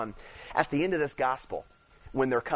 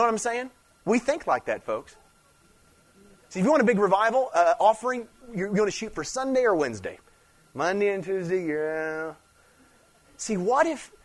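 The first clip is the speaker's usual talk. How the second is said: higher, louder, fast preacher drive; fiery preaching; 5 semitones higher, 4 dB louder than usual. Acted. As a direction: push it like a revival climax. Preaching peak.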